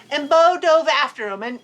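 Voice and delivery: usual speaking voice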